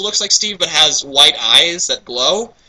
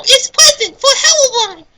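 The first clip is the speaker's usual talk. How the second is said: very high laughing squeak; high giggling creature voice; squeaky creature; 5 dB louder than usual. Acted. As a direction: chirp it like a tiny creature.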